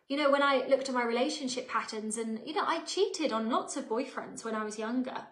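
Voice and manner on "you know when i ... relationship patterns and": normal talking voice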